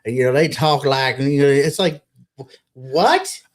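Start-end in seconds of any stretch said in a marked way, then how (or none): 2.4-3.4 high, incredulous mocking voice; mock disbelief. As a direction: squeak out a baffled why do you talk like that. Mocking someone's fake Texan drawl.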